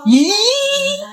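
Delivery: high giddy squeal of delight; kilig squeal. Acted. (matinis na kilig na tili)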